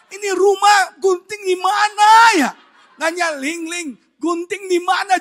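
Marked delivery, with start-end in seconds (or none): 0.1-2.5 loud, high wail of a question; hammed-up helpless yelling; exasperated shout. Husband re-enacts hunting for scissors at home, exasperated shout. 3.7-5.2 loud, high call to another person; hammed-up helpless holler. Husband re-enacts asking his wife for scissors, loud call.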